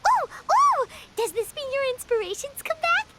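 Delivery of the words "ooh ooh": high, loud excited ooh; excited squeal. Real, not acted. Paimon sees the writer's inspiration return, squealing high. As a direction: bounce up with delight.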